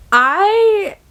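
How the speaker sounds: high burst of laughter on "I"; giggling burst; laughing. Real, not acted. Laughing outburst before love.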